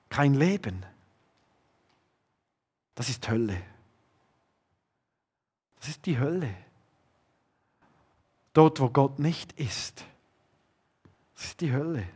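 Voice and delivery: hushed. Acted. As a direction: let each word about hell sink in softly. Hushed description of hell. (leise und eindringlich, fast gehaucht)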